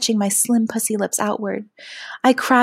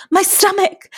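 high, loud gasping cry; high-pitched breathless outburst; gasped; 12 semitones higher, 5 dB louder than usual. Acted. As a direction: cry out in alarm with a gasp. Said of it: "I gasped".

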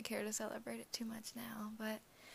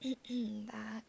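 ordinary talk, nothing marked

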